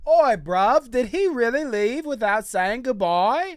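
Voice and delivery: loud, high mock-British accent; Cinnamon's British accent. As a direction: play an incredulous, hammed-up Brit. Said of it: "Cinnamon's like".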